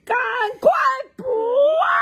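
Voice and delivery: 拖长音高声呼喊; 全家一起朝天上喊. A narrator's reading. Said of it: "一家人全部一起大喊哦". (一家人一起大喊)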